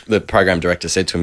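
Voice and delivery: ordinary delivery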